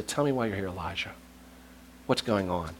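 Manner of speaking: fatherly whisper. Retold or imitated. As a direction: coax him gently like a loving father. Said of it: "a father whispers to his children".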